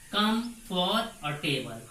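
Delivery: कम, फॉर, table as three distinct pieces; separated syllables. Shown as a demonstration. Teacher breaking a word into its syllables, piece by piece. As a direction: count out कम, फॉर, table with small gaps. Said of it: "इसमें तीन syllable है".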